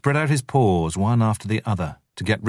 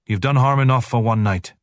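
higher, sharp angry dismissal; snapping wolf; 5 semitones higher, 3 dB louder than usual. Acted. Father Wolf snapping at the jackal to leave. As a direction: throw him out curtly. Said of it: "snapped Father Wolf".

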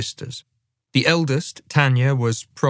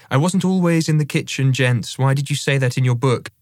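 higher-pitched protesting mother; mock-indignant mum; 4 semitones higher than usual. Mum having a go at her son over his book, mock indignant. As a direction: give it a mum's mild telling-off. Narrator voices Mum's imagined telling-off.

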